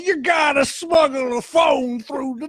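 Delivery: high, slow rambling voice; drawn out Cosby ramble; Bill Cosby impression. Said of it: "I could do a great Bill Cosby".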